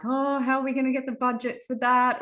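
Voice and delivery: high, fretful what-will-we-do whine; mock worried. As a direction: act out the budget panic. Mock worried quote of themselves.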